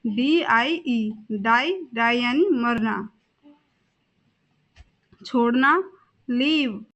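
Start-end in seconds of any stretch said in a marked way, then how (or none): 0.0-1.1 spelled out. Shown as a demonstration. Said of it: "इसकी spelling होती है".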